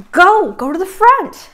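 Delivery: high, loud, slow command; sharp order. Retold or imitated. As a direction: bark it like a boss. Boss cutting her off.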